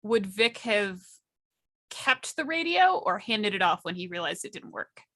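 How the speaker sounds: usual speaking voice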